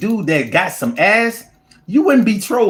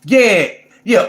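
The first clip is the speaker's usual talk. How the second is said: ordinary talk, nothing marked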